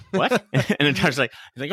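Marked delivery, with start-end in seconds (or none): 0.1-0.4 high startled squeak; shocked what. Retold or imitated. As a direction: blurt it out in shock. Doctor shocked in retelling.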